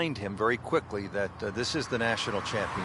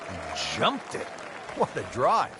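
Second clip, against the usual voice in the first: higher, thrilled play-by-play call; excited; 5 semitones higher than usual. Acted. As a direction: call the big drive with delight. Commentator reacts to huge drive.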